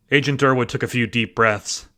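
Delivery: ordinary delivery, nothing marked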